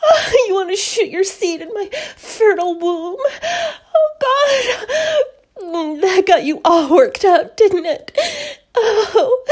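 high, loud, moaning cries; acted passion. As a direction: cry out breathlessly in mounting arousal. Acted passionate sex scene.

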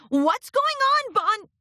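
high shout muffled through coats. Acted. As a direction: call out from inside a closet. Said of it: "Lyra's muffled voice shout".

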